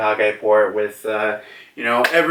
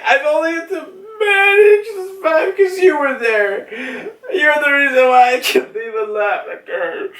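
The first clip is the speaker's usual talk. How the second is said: high, wailing mock-crying confession; imitated blubbering, squeaky and desperate; tearful breakdown; 13 semitones higher than usual. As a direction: plead through hammed-up sobs, drawing out the end. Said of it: "starts breaking down in tears".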